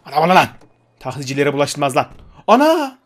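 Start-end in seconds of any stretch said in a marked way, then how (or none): 2.5-3.0 sudden high yelp of shock; startled cry at the crash; surprised yelp. Real, not acted. Crashing taxi in a game.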